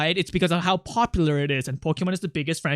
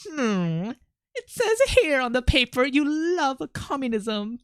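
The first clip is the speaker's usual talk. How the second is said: higher put-on character voice; Birdley voice as Kim; 7 semitones higher than usual. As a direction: try out Birdley's voice on Kim's line. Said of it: "No, that's not Birdley voice".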